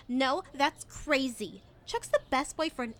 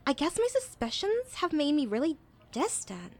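usual speaking voice

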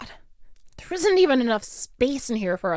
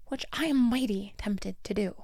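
whispered threat; 6 dB quieter than usual. Acted. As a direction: breathe the threat under your breath. Bully's menacing aside.

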